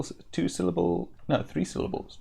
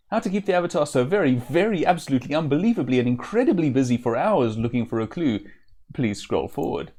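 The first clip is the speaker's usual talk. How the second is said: fast, louder rush through the list; rushed reading; 3 dB louder, faster than usual. Shown as a demonstration. Reading the jester's endless scroll to viewers, fast and louder. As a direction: rattle it off faster and faster. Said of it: "last, longest repeat of scroll".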